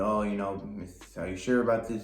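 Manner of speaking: ordinary talk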